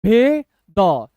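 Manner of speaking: very slow, two separated sounds; letter by letter. Shown as a demonstration. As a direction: hold each letter on its own. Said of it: "एक एक अक्षर आपको तोड़ के".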